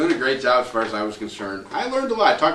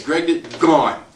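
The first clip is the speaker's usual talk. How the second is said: usual speaking voice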